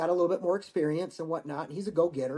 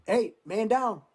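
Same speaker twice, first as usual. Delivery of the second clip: raised-pitch casualty call; higher reenacted callout; retold yell; 6 semitones higher, slower than usual. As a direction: call out an alert to the squad, without full force. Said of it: "I yelled at him".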